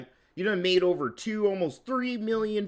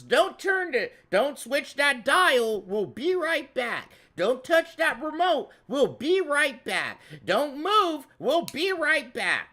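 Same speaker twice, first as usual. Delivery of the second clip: high-pitched, drawn-out TV announcer parody; mock TV bumper announcer; 7 semitones higher, slower than usual. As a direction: stretch it out high like a hammed-up commercial bumper. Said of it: "fucking shout at you on T V".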